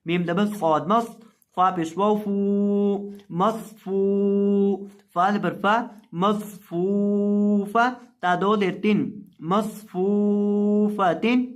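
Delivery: slow chanted spelling, syllables drawn out; Quranic spelling recitation. Shown as a demonstration. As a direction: recite each syllable, then the whole word. (धीमी तिलावत में हिज्जे)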